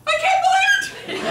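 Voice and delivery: high, loud, incredulous cry; outraged disbelief. Acted. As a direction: yell it in scandalized shock. Outraged reaction to betrayal.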